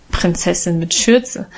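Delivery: good-natured teasing. A narrator's reading. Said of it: "spottete Guste gutmütig lachend".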